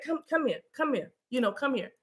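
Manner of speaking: soft beckoning, voice dropped; gentle coaxing. Acted. Calling things out of the shadows, soft coaxing. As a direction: coax softly, as to a hiding child.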